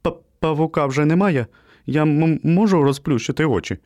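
high, stuttering nervous question; fearful stammer. A narrator's reading. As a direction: stumble over first sounds, scared. Scared puppy stammers, eyes shut.

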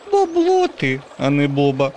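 put-on very high, teasing girl voice; mocking girl. A narrator's reading. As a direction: tease him like a cheeky kid. Girl mocks the zombie. (дражнить дуже високим дівчачим голосом)

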